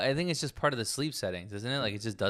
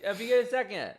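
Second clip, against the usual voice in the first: high, full-volume call across the house; real loud, high-pitched shout to someone out of earshot; loud yell; 12 semitones higher, 7 dB louder, slower than usual. Real, not acted. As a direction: holler for your partner at the top of your lungs. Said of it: "the really loud yell".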